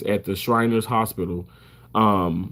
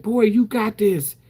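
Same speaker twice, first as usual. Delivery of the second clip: mom's encouragement; 8 semitones higher than usual. Retold or imitated. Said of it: "She said".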